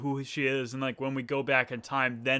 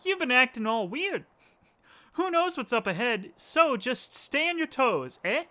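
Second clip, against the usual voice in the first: playful put-on voice; game character voice; 9 semitones higher than usual. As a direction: voice the kid warning her friend.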